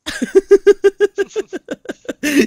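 high burst of real laughter; loud laugh. Real, not acted. Streamer cracking up at another player, laughing loudly.

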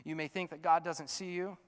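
ordinary talk

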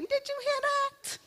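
high excited parent voice. Retold or imitated. Parents thrilled at baby's babble, high excited squeal. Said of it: "and they start asking".

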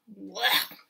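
ordinary delivery, nothing marked

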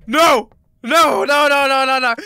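loud panicked no; panicked screaming. Real, not acted. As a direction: yell no in fright. Panicked at the enemy.

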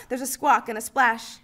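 ordinary delivery

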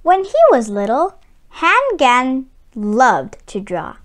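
calm storybook reading, evenly paced; story narration. A narrator's reading. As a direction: read it like a picture book to children. Reads the story aloud.

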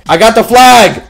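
excited victory call; triumphant shout. Real, not acted. Capturing the flag in game.

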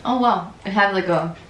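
chewing while talking; words muffled by chewing; mouth full of candy. Real, not acted. Seen 3: woman bites candy piece, hand at mouth while speaking.

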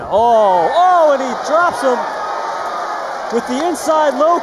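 high, loud knockdown call; excited shout. Real, not acted. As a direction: yell it as the fighter drops. Commentator reacts to knockdown.

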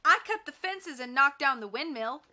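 high, loud angry boast; shrill anger. A narrator's reading. Henchman argues over his part in the plot, shrilly angry. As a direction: claim credit in shrill indignation. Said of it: "men's voices shrilled in anger".

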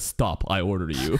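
mock deep commanding voice; deep man voice. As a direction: bark it like a stern boss. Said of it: "deep man voice".

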